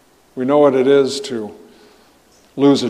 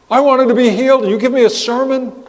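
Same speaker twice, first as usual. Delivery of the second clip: higher, louder whining complaint; indignant crowd; 9 semitones higher, 4 dB louder, faster than usual. Retold or imitated. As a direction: voice the crowd's outrage at getting a sermon. Disappointed crowd complaining to Jesus.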